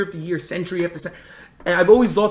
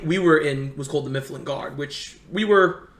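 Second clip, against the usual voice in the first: ordinary delivery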